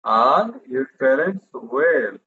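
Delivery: very slow model question. Shown as a demonstration. Teacher slowly modelling a question for the class. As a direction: stretch every word of the question slowly. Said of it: "কোনটা আগে আসবে?".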